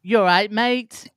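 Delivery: slow, high mock English greeting; English accent. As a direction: ham up a northern English accent. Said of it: "I'd be like, eleven years old".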